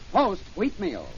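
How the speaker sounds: ordinary delivery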